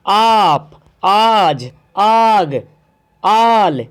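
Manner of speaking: आप आज आग आल with each आ stretched long and loud; drawn-out long aa. Shown as a demonstration. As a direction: pull each opening आ long. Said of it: "खींच के पढ़ रहे हैं".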